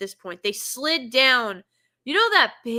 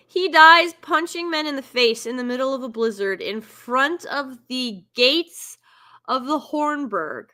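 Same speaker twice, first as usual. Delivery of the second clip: ordinary delivery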